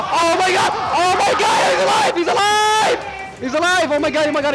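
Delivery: high and loud, joyful yelling; ecstatic shout. Real, not acted. Caster celebrating a survival to the crowd, ecstatic shout. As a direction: scream it like a miracle happened.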